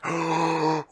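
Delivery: high, breathy gasp of delight; a thrilled intake of breath; excited gasp. Retold or imitated. Friend spotting the pineapple Fanta bottle, gasping with excitement.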